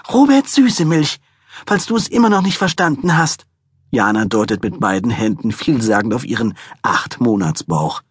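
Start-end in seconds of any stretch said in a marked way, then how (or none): 0.1-3.5 crazed preschooler. A narrator's reading. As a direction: scold him like a raging little girl. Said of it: "wahnsinnig gewordenen Vorschülerin".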